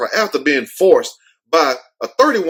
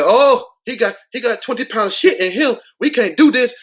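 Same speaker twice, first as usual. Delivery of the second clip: high, panicky mock attendant voice; mock horrified attendants pitched up high; flight attendants freaking out; 7 semitones higher than usual. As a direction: squeal it like a horrified stewardess. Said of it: "They're like".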